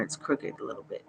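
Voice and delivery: a quick confiding whisper; whispered aside. Real, not acted. Streamer confiding about her crooked eyeliner, whispered.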